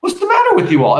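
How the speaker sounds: high, loud indignant cry. Acted. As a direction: rebuke them sharply, offended. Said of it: "Mama indignantly".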